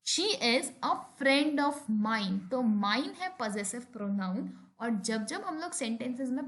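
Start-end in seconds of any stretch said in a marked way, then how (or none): 0.1-2.4 very slow, each word set apart; slow example sentence. Shown as a demonstration. Teacher reading an example to the class, very slow. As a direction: read it out word by word. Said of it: "कुछ और examples देखते हैं".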